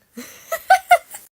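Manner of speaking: high, loud cackle; burst of laughter. Real, not acted. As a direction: crack up loudly after the bit. Laughs after own impression.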